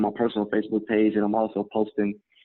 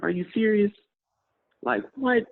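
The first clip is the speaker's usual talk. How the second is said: incredulous squeal; stunned disbelief; 12 semitones higher than usual. Retold or imitated. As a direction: gasp it out, can't believe it. Second stunned reaction.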